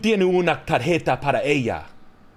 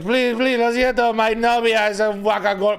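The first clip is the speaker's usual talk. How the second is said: mock pleading drunk; 7 semitones higher, 3 dB louder than usual. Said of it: "I'm like".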